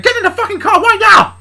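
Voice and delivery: high, loud furious order; angry. Acted. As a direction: yell the order angrily at your daughter. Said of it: "pushed away angrily, he said".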